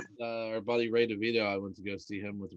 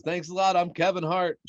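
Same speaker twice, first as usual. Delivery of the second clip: high, loud shouted sign-off; Kevin Hart sign-off; 9 semitones higher, 11 dB louder than usual. Retold or imitated. Podcast guest mimicking Kevin Hart's sign-off, loud high shout. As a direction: belt it out like a headliner closing his set. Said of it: "I like how he does that at the end".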